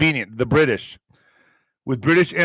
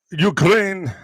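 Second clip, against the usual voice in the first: normal talking voice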